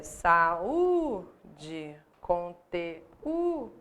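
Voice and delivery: "conteúdo" drawn out slowly, con-te-Ú-do, the Ú held; slow hiatus demo. Shown as a demonstration. Teacher to class, slowly showing the hiatus. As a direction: stretch "saúde, conteúdo" to show the hiatus. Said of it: "é hiato".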